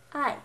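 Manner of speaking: "I" said firmly with a sharp fall; fourth tone. Shown as a demonstration. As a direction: drop the pitch hard from high on "I". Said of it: "here are the four tones".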